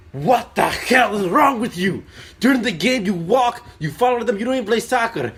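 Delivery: high, full-volume furious dad tirade; imitating an enraged father screaming from the sideline; angry dad yelling. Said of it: "He yells at us, like".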